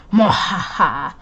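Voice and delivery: slow evil cackle; evil laugh. Acted. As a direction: cackle like a villain. Villain laugh.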